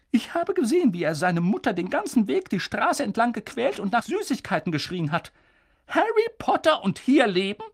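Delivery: stern witch pushing for answers; urgent McGonagall. A narrator's reading. McGonagall protesting.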